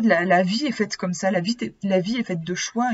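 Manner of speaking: usual speaking voice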